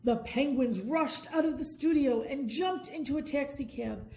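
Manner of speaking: ordinary delivery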